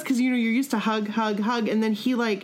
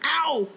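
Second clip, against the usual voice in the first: mock ow; 8 semitones higher than usual. Mock pain from tight hug.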